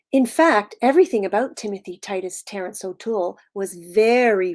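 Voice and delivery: animated storytelling with punched key words; story read-aloud. A narrator's reading. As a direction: read to preschoolers, leaning on the describing words. Reads picture book to children.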